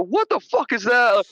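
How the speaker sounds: high-pitched mock alarm; guys' startled reaction. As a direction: imitate men crying out in disbelief. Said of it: "you hear all the guys go".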